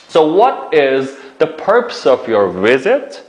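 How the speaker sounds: each word slowly, clearly; slow model sentence. Shown as a demonstration. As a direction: spell it out slowly for learners. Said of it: "teacher models key sentence slowly".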